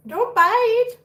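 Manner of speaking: high, loud pained command; yelped scolding. Real, not acted. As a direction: cry out at a nipping kitten. Scolding a biting kitten.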